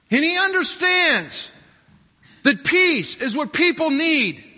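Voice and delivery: loud, high, driving preacher's voice; preaching at full force. Acted. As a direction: proclaim it to the back row. Preaches the triumphal entry.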